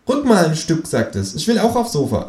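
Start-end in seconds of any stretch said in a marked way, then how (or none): 0.1-0.9 high, cheeky kangaroo voice. Acted. Kangaroo asks narrator to shove over, high cheeky. 1.4-2.3 high, cheeky kangaroo voice. Acted. Kangaroo asks narrator to shove over, high cheeky.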